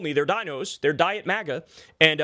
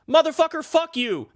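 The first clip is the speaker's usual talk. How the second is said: angry outburst; 9 semitones higher than usual. Real, not acted. Angry outburst at right-wingers.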